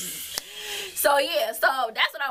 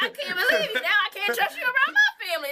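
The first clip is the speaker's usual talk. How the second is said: pitched-up disbelief; disbelieving quote; 9 semitones higher than usual. Retold or imitated. Telling him she can't trust him, pitched-up disbelief. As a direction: act out the shock. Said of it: "So I was like".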